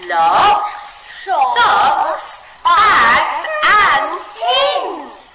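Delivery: high, loud, slow, drawn-out list; big expression. Shown as a demonstration. Teacher reading the list with big shocked expression. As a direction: sound amazed at all the mess. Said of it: "read that bit together again".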